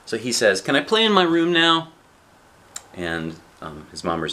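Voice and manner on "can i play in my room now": put-on high, loud little-kid voice; child asking. Child asks a parent to go play, high child voice. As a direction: ask eagerly in a raised child's pitch.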